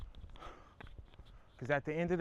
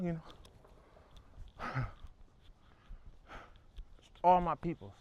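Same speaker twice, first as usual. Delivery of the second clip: ordinary talk, nothing marked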